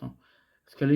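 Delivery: ordinary talk, nothing marked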